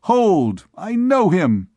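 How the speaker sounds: loud, high eager call; excited recognition. A narrator's reading. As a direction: announce it eagerly. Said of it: "said one of them".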